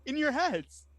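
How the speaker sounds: put-on high, fast excited kid voice; eager chatter. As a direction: rattle it off, thrilled with the new trick. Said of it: "You hear in your minds".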